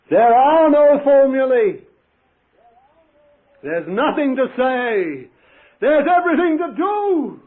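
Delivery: high, loud, slow proclamation; full-force preaching. Acted. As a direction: preach it to the back of the hall.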